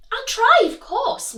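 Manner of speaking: high, loud lady's voice; reply graciously; grand lady reply. Acted.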